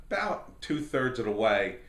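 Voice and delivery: ordinary talk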